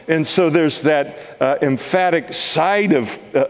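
ordinary talk

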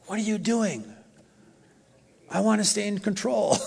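high-pitched mock protest. Preacher mimicking himself arguing with God, protesting.

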